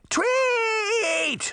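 very high, drawn-out screech of a demand; princess's roar. A narrator's reading. Princess cuts off her servant, high roaring demand. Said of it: "she roared".

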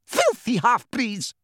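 high, slow, hateful shriek; shrieking Umbridge, pitched high; screamed insult. A narrator's reading. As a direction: scream it in terror and disgust, drawn out. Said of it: "she screamed".

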